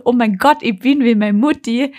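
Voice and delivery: shocked cry that she's like her mum; mock horror. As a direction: wail it like the worst news. Second thought, louder horrified realization.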